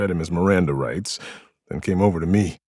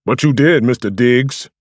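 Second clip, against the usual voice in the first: sharp, louder retort; snapped; 5 semitones higher, 9 dB louder than usual. A narrator's reading. Samson snapping back at Diggs, sharp retort. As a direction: cut him off hard. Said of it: "Samson snapped".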